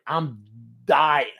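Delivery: ordinary delivery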